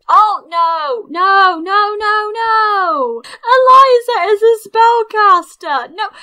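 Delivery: loud, rising cries of dismay; drawn-out panicked exclamation; alarmed outcry. Real, not acted. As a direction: shout it high in real horror. Dismayed to see rival caster.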